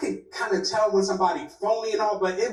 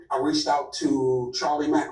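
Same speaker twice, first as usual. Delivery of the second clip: ordinary delivery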